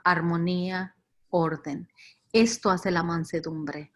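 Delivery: normal talking voice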